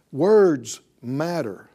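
usual speaking voice

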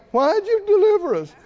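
high, loud mock complaint; complaining. Preacher voices Israelites wishing for Egypt, complaining. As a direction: protest like ungrateful grumblers. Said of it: "And then they go".

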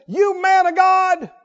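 high, slow, shouted mock title; sarcastic. Captain to Elijah on the hill, sarcastic shout. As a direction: call out a title with no respect.

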